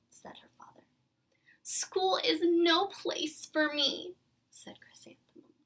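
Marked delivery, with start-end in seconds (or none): 1.6-4.1 slow, loud, tearful plea. Acted. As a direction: wail it like a heartbroken child. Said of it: "repeat of tearful plea".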